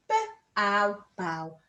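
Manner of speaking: B, ow said apart then blended into bow; sounding out. Shown as a demonstration. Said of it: "Sound it out".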